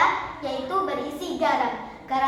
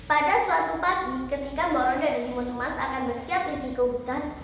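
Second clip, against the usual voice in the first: ordinary delivery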